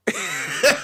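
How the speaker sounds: high, loud burst of laughter. Real, not acted. Storyteller cracking up at her drunken reply, loud laughing.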